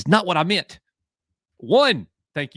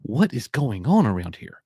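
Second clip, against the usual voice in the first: normal talking voice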